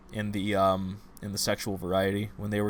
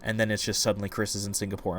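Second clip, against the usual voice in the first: normal talking voice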